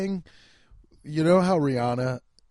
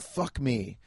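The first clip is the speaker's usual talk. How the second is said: ordinary talk, nothing marked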